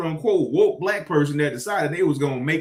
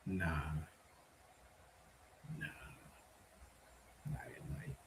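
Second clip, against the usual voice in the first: whispered aside; 10 semitones lower, 25 dB quieter than usual. Real, not acted. Muttered aside.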